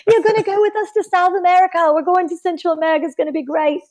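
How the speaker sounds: very high-pitched mock Australian; excited, botched Australian that drifts toward British; bad Aussie accent. Australian friends urge her to travel along, high-pitched in a bad Aussie accent. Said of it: "my Aussie accent is shit".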